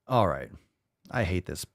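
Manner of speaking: ordinary delivery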